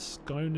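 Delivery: "Scone" with a long o like boat, quietly. Shown as a demonstration. Streamer testing the other version for chat, "Scone" rhyming with boat. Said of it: "is in boat".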